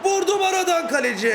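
very high cry, falling on the last word; shouted shot call. Real, not acted. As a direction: yell the shot, then lament the keeper. Shot saved by the keeper. (heyecanla haykırarak)

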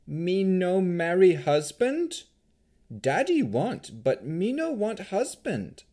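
high, slow golem voice; high, halting childlike protest. A narrator's reading. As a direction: plead slowly like a simple creature.

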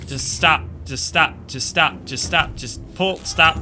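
loud, repeated frustrated pleas; exasperated pleading. Real, not acted. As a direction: beg the game to stop. Frustrated at the game.

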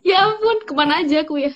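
high, loud exclamation of shock; real, high-pitched disbelief; incredulous. Real, not acted. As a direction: exclaim in amazement at yourself. Astonished at her own ignorance.

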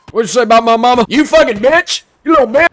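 high, loud screamed insults; furious yelling. Real, not acted. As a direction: scream at him in rage. Raging at enemy about mama.

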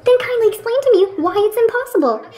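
high, exasperated demand; indignant challenge. Acted. Friend demanding an explanation in a quarrel, high indignant challenge.

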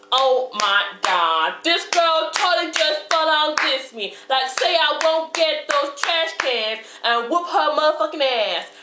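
put-on tough-talking girl outraged over her chicken; sassy, indignant girl. Confronting the girl who stole her chicken, indignant.

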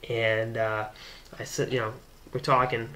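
ordinary delivery

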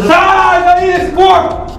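slow, drawn-out shout; shouted exorcism command. Acted. Exorcismo improvisado, ordem ao demônio. (grito lento de exorcista)

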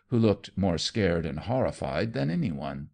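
usual speaking voice

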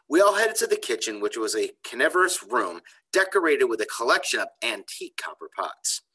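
put-on lively, expressive story reading for kids; reading to children. A narrator's reading. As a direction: read it like a storyteller to a class. Teacher reads chapter to kids.

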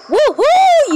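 high, loud triumphant whoop; real excited woohoo; whooping cheer. Real, not acted. Celebrating gang victory.

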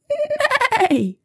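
high, drawn-out whinny; whinny high like a horse; goose neighing with all her might. A narrator's reading. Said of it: "with all her might".

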